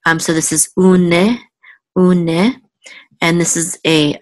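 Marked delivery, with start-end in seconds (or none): 0.8-2.6 "une" as two syllables, oo-neh; sounded shava. Shown as a demonstration. Teacher sounding out the shava, "une" with an audible "neh". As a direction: voice the short "eh" at the end of "une". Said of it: "we will pronounce the shava this time".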